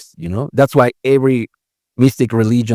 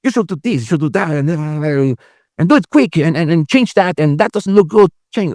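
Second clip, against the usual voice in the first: much higher, pushy boss giving orders; the ego nagging like a boss, pitched up; bossy ego voice; 5 semitones higher than usual. Retold or imitated. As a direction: bark orders like a demanding boss. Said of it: "The other one is like your boss".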